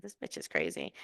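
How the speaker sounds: low snide aside; catty inner voice. Retold or imitated. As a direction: mutter it like a judgmental stranger. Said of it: "in my head, probably would have said".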